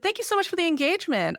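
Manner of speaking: high, quick, sugary thank-you; mock-polite thanks. Mock-polite reply to a critic.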